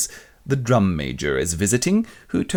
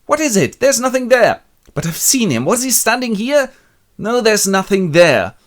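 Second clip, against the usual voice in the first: much higher, louder denial; high defensive voice; 13 semitones higher, 7 dB louder than usual. Retold or imitated. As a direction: deny it shrilly. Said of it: "And she goes".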